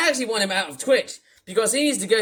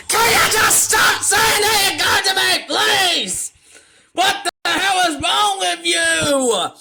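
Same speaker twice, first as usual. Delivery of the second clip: furious ranting shout; 6 semitones higher, slower than usual. Acted. Yelling abuse at the streamer who tricked him. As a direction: scream the insults out, dragging each word. Ranting rage at Otis Beer.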